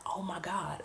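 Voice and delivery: very soft, under the breath; hushed dismay. Retold or imitated. Realizing the I D is forgotten, hushed dismay. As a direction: let it out as a near whisper.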